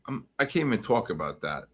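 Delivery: ordinary delivery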